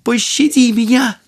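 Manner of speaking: high, terrified plea for mercy; pleading in fear. A narrator's reading. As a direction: beg for your life. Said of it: "закрывая себе лицо руками".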